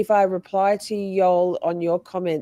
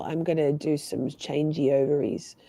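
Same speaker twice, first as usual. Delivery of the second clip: stuffy nasal voice; talking through a stuffed nose; nasally voice; 4 semitones lower, 6 dB quieter than usual. Real, not acted. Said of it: "Sorry about my nasally voice".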